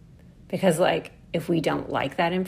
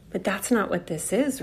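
ordinary talk

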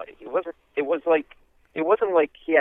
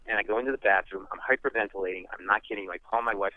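ordinary talk

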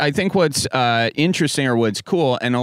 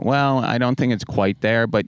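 ordinary talk, nothing marked

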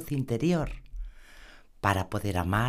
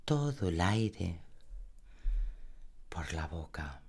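guided meditation; 3 dB quieter than usual. Acted. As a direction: lull the listener into calm. (voz suave de meditación guiada)

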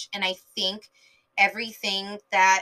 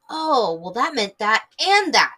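louder, higher "oh" of dawning understanding; acted-out realization; 3 semitones higher, 6 dB louder than usual. Fan rereading old dialogue, acted-out realization. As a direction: act out pieces suddenly clicking, hitting each "that". Acts out realizing a hidden meaning.

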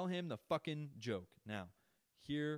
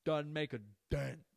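slow, loud, a touch higher, emphatic; proud quote of a catchphrase; catchphrase with a hint of Crystalia; quoting Crystalia; 6 semitones higher, 7 dB louder than usual. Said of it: "As Crystalia would say".

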